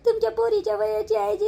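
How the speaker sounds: ordinary talk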